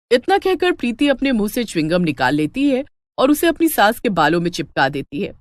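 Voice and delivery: ordinary delivery, nothing marked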